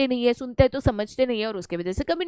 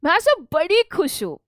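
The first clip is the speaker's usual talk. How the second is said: higher, lively delighted voice; very happy; 5 semitones higher than usual. Shown as a demonstration. Teacher shows expression matching happy words, upbeat delight. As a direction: say it brimming with happiness. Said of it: "तो ये match करता है".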